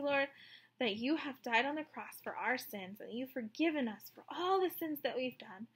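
soft, hushed prayer voice. Real, not acted. Teacher leading the children in prayer: soft and reverent. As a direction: pray quietly and reverently.

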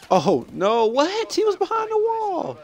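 high-pitched, incredulous protest; shocked outcry. Real, not acted. Gamer protesting a death from behind the wall, shocked outcry. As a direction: cry out in real disbelief, pitch soaring. Shot through wall in game.

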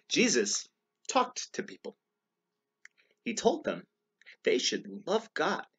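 deliberate storybook reading with short pauses; read-aloud to children. A narrator's reading. Reader tells children about Jesus, storybook narration. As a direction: read each phrase out clearly.

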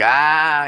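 loud mock child's "ah"; kid's shout at the sound-reactive square. Kids yelling at the sound square, loud playful ah. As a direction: yell "ah" at the square like a small kid. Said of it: "my kids would play with these things like".

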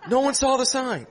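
high-pitched exasperated retort; mock-yelled denial; retold shouted comeback. Acted comeback to the heckler.

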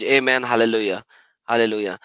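normal talking voice

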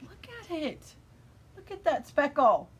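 high-pitched marvelling; delighted gushing. Real, not acted. Knitter showing viewers her speckled hat, delighted. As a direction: show it off with rising delight. Gushing over yarn colors.

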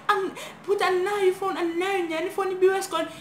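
high-pitched, panicky retold plea; acted pleading. Girl telling intruders she has no phone, pleading.

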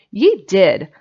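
put-on loud, insistent childish contradiction; squabbling retort. Jimsy contradicts Specs in a squabble, loudly insistent. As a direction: throw it straight back at him. Boys squabble back and forth.

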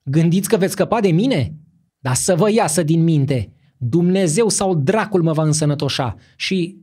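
loud angry shouting; furious defiance. A narrator's reading. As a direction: hurl threats at them. Ruler defies the monks.

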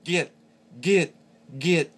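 high, loud goose-bark GET. Shown as a demonstration. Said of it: "to make the higher pitched bark".

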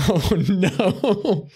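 laughing through the words. Real, not acted. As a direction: laugh while saying it. Laughing after own joke.

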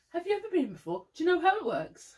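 high and loud waiter imitation; snooty waiter. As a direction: lilt it up like a fussy host. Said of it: "they kind of go".

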